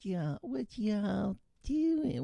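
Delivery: put-on coaxing a co-host into his Southern accent; Southern drawl. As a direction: stretch it out in a teasing Southern accent. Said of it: "really bad Southern drawl".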